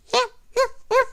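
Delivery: very high yelps; playful puppy yelps; puppy yelping. Acted. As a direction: yip like an excited puppy. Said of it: "yelled Timmy's puppy".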